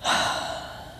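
long, soft breathy sigh; deep sigh of sympathy; heartfelt sigh. Shown as a demonstration. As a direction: let out a sigh straight from the heart. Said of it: "a sigh that came straight from the heart".

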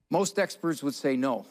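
normal talking voice

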